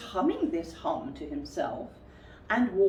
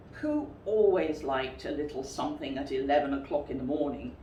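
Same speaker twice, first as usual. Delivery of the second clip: ordinary delivery, nothing marked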